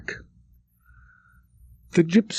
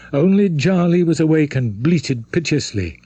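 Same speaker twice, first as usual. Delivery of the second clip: plain reading voice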